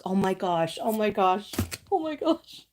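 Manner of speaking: overwhelmed gasp. Real, not acted. Card moves her, rising emotion.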